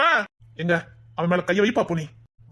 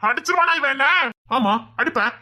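usual speaking voice

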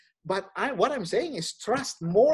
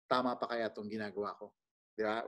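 quiet doubting thought; 8 semitones lower, 11 dB quieter, faster than usual. Retold or imitated. Voicing a doubting believer's thought.

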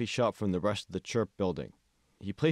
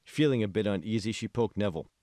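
plain reading voice

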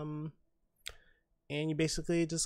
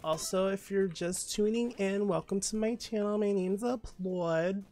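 talking all weird; 5 semitones higher, 4 dB louder than usual. Real, not acted. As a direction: put on a strange intro voice. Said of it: "I'm talking all weird".

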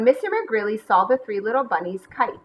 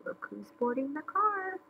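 soft, higher-pitched conspiratorial aside; hushed aside; 7 semitones higher, 10 dB quieter than usual. Acted. Narrator tips off listeners about stowaways, hushed aside. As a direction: let the listeners in on it quietly.

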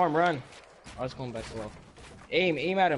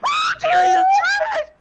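shrieking laugh at a teammate; laughing shout; 7 dB louder, slower than usual. Real, not acted. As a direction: shout it while cracking up.

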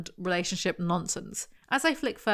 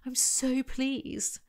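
high, softer, slowly gushing; drawn-out delight; 5 semitones higher, 4 dB quieter, much slower than usual. Acted. Ava hears Matt likes her oil, drawn-out delight. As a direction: stretch it out with pleasure. Acts out delight at his praise.

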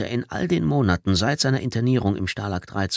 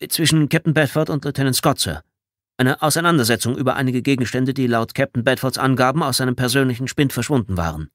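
higher-pitched, dutiful military report; formal soldier's report, pitched up; lieutenant reporting; 5 semitones higher, 3 dB louder than usual. Acted. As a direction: report formally to a superior, voice raised.

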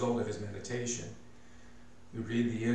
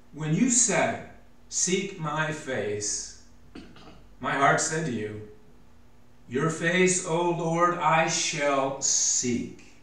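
louder, higher read, drawn out slow on quotes; fervent scripture reading; 5 semitones higher, 6 dB louder, slower than usual. Acted. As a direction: linger on Seek my face and I shall seek. Reads verse eight fervently.

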